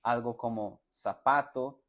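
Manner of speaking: ordinary talk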